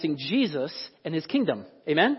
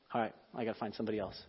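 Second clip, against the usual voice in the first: fast, low, much softer aside; gossip muttering; 10 semitones lower, 16 dB quieter, much faster than usual. Retold or imitated. Gossip mutters while seeking the next listener, quick and low. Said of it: "If I'm going".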